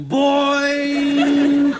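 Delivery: loud, high, held imitated boing; drawn-out cartoon spring noise. Zebedee bouncing into the story, loud comic boing.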